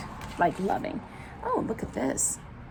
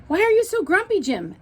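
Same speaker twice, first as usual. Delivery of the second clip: high curious lemur; 9 semitones higher than usual. Acted. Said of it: "asked Lemur".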